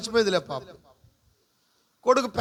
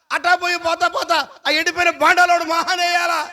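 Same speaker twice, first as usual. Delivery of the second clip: raised, insistent run of rhetorical questions; fervent preaching; 6 semitones higher, 4 dB louder than usual. Acted. Pastor pressing the crowd with questions, fervent preaching voice. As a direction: drive each question home to the congregation.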